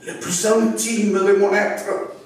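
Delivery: slow declamation with long pauses, swelling from hushed lines to high cries; dramatic poem recital. Acted. Poet reads his Notre-Dame fire poem to an audience, dramatic declamation. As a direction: recite it in incantatory waves, near-whisper rising to ringing intensity. Je vais lire ce, ce poème.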